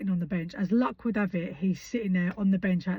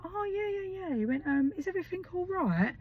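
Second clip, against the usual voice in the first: doctor recognising the mum, high and flustered; 11 semitones higher than usual. Retold or imitated. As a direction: squeak like a man caught out. Doctor caught off guard, voiced.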